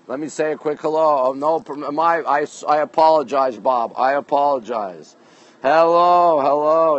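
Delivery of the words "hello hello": loud greeting. Real, not acted. Host greeting his Periscope viewers with a called-out hello. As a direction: call out hello to the crowd.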